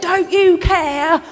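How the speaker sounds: high, drawn-out mock protest; storming in indignantly. Martha storms in to Jesus, indignant high protest. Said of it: "Martha sort of storms in".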